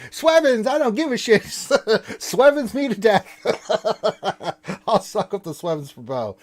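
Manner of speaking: high, loud mock outburst breaking into laughter; gleeful bravado. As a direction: crow it recklessly, laughing through the words. Acting out gleeful reckless spending.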